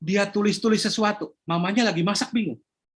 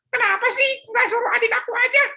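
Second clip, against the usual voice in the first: put-on high childish whine; sulky boy; 14 semitones higher than usual. Philip complaining to his mother about errands, high whiny child voice.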